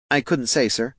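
hesitant answer to a superior; meek underling. A narrator's reading. Underling answers his boss meekly.

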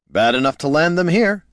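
high, loud, rising grin in the voice; grinning quip. A narrator's reading. Bard capping the story, loud grinning quip. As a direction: toss it out gleefully through a grin. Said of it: "said Heraldon with a grin".

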